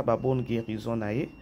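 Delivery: ordinary talk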